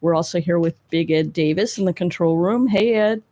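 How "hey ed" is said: normal talking voice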